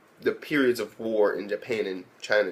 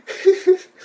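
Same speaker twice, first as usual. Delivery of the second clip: high laugh; 7 dB louder than usual. Real, not acted. Burst of laughter at scene.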